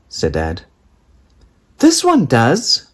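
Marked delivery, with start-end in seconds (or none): 1.8-2.9 put-on high, slow insistent child voice; child's cry. A narrator's reading. Daisy insisting to Dad, high child cry. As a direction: plead it high like a small girl. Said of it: "cried Daisy".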